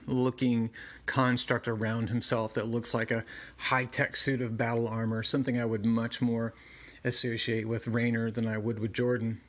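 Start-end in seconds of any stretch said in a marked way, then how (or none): none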